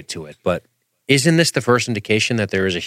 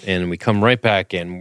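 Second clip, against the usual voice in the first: normal talking voice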